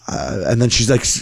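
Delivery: ordinary delivery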